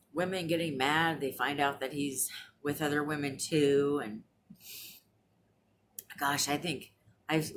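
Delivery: ordinary delivery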